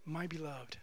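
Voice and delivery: very soft, tender murmur; affectionate. Retold or imitated. Grieved loving friend softly calls a wrongdoer: tender, hushed. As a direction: say it like a gentle caress of the heart. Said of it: "respond with affectionate words like this".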